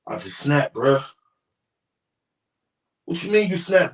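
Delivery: low gangster reenactment; low acted street voices. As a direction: act out the tense phone call. Reenacts the call between the two men.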